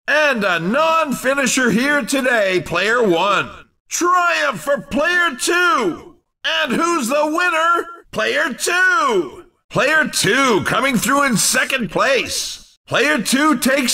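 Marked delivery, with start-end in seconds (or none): 3.9-9.5 high-pitched, excited announcer; excited sports-announcer cry; triumphant winner call. Acted. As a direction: crown the winner with relish. Announcer calls the race winner.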